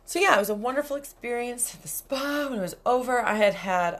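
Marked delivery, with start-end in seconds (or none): none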